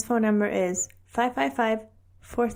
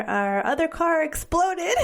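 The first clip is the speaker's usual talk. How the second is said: raised, drawn-out mock announcement; husband's announcement; 7 semitones higher, slower than usual. Wife retelling husband's insurance call, mock announcement. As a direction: announce it high and slow, playfully.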